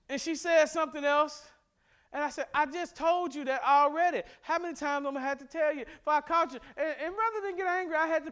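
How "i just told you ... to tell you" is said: short with her. Retold or imitated. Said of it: "I was a little short with her".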